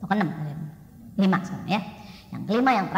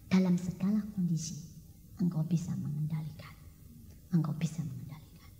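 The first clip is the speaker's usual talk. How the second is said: soft unvoiced whisper, lower, repeated; 9 semitones lower, 5 dB quieter than usual. Real, not acted. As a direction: whisper the promise gently. Sermon, repeated inward encouragement.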